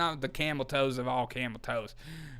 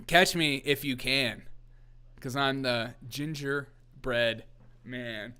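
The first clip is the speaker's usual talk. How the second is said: taunting singsong; 3 dB quieter, slower than usual. Retold or imitated. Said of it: "he is like".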